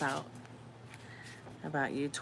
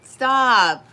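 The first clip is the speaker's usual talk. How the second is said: loud, higher shout of stop; sharp shout; 8 semitones higher, 15 dB louder than usual. Real, not acted. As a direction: cut the dog off loudly. Yelling at the screaming dog.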